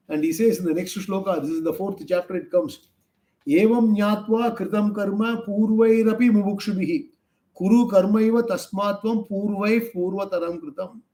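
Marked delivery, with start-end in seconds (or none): 3.5-11.0 verse chanted slightly higher; Gita verse recitation. Shown as a demonstration. Quoting next Gita verse.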